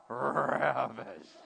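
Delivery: "ravished" low and slow with a long rolled r; relished growl. Shown as a demonstration. Preacher correcting how the verse is read, growling it with relish. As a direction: roll out the r of "ravished" like a purr. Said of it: "What he's saying is".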